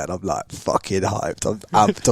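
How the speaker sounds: usual speaking voice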